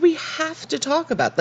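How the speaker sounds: voice starting to rise. Retold or imitated. Host retells Felicity pressing Ben to talk, voice rising. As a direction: imitate her raising her voice without yelling. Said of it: "she is starting to raise her voice".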